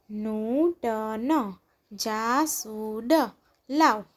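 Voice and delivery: નૂતન જાસૂદ લાવ slow, each syllable stretched; drawn-out નૂતન જાસૂદ લાવ, syllables separated. Shown as a demonstration. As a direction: sound out નૂતન જાસૂદ લાવ slowly, pausing between words. Syllable-by-syllable reading, then blended words.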